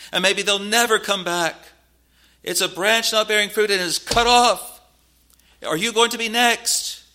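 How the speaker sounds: loud, high-pitched pulpit warning; full-force preaching. Real, not acted. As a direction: thunder the warning at the congregation.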